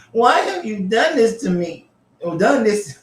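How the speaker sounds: high, slow put-on donkey voice; talking donkey voice. Reading the donkey's complaint to Balaam in a high, slow donkey voice. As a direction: plead like a wounded little donkey. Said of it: "doing the little donkey sound".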